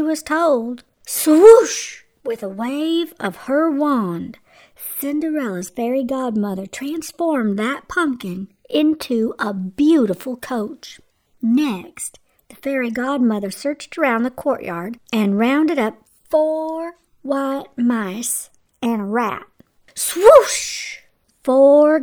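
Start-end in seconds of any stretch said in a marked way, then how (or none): 1.1-2.0 high, loud magical whoosh; wand swoosh. A narrator's reading. Fairy godmother waving her wand, loud swoosh. 19.9-21.0 high, loud magical whoosh; wand swoosh. A narrator's reading. Fairy godmother waving her wand, loud swoosh.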